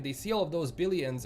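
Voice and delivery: usual speaking voice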